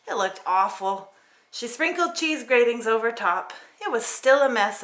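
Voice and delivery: plain reading voice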